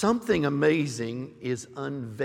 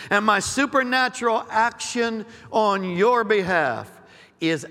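loud, stirring declarations; prophetic declaring; 4 semitones higher, 3 dB louder than usual. Acted. Prophet to the church, stirring declaration. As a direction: proclaim each promise with force.

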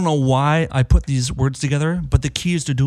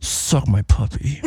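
teeth together; 5 semitones lower, 4 dB quieter, slower than usual. Retold or imitated. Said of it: "I was like".

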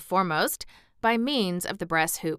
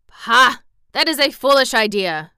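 loud, higher, scoffing retort; irritable retort; 4 semitones higher, 9 dB louder than usual. A narrator's reading. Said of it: "retorted Guff, irritably".

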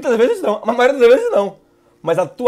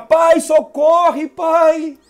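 higher pitched, urgent begging prayer; desperate supplication; 6 semitones higher than usual. Shown as a demonstration. Pastor demonstrates supplication prayer, pitched high and pleading. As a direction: plead with God, one request after another. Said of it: "Súplica".

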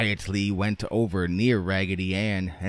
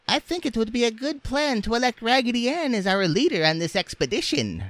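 quick, very high doll voice; squeaky Indian doll; faster than usual. A narrator's reading. Doll proposing a leader to the others, squeaky doll. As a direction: rattle it off in a squeaky falsetto.

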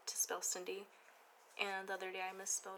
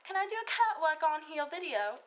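put-on high, loud British accent, quoting a girl; imitated British accent; 8 semitones higher, 8 dB louder than usual. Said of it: "Because you gave him an accent".

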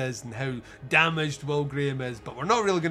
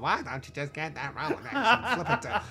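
imitating a hammy Lecter accent through laughter; half-laughing send-up of the villain's accent; mock Hannibal bad accent. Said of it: "even the Hannibal doing the bad accent".

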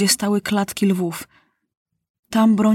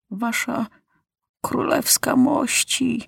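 put-on an old general barely holding steady; trembling voice; 5 semitones higher, slower than usual. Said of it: "drżącym głosem przemawia".

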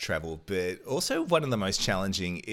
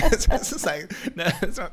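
laughing; 14 semitones higher, 8 dB louder than usual. Real, not acted. Laughing at own fake accent.

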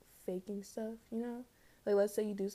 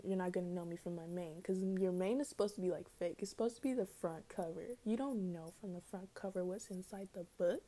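ordinary talk, nothing marked

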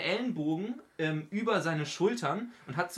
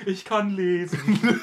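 laughing slowly; 4 semitones higher, 7 dB louder, much slower than usual. Acted. Mock-proud slow claim.

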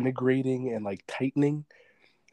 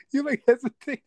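laughing; 13 semitones higher, 5 dB louder than usual. Real, not acted. Laughing at the failed bit.

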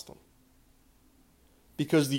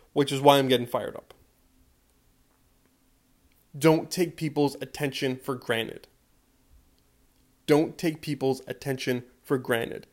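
usual speaking voice

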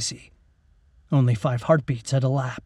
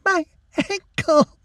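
high, strained acted sob; injured girl sobbing to the soldier, high and tearful; 3 dB louder, slower than usual. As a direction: cry out the words in pain. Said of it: "she sobbed".

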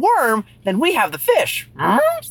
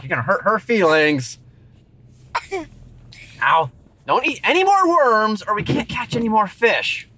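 usual speaking voice

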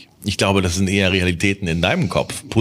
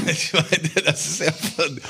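normal talking voice

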